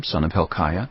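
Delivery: normal talking voice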